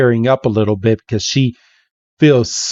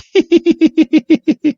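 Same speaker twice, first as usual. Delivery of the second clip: high-pitched tittering giggle; put-on high giggle from the maids; maids' mock laughter. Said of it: "all the maids like".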